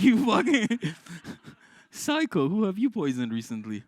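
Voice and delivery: high, loud laughing outburst; laughing mock yell. Teacher yelling at a pupil who mentions poison, laughing. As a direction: yell it half laughing at the kid.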